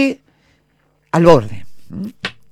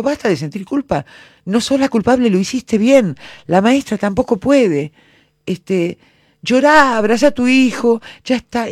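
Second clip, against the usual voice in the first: high-pitched, emphatic retold consoling; insistent reassurance; 9 semitones higher, 5 dB louder than usual. Speaker retelling how she consoled a guilt-ridden mother, insistent reassurance. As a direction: urge her firmly to stop blaming herself, pitch lifted.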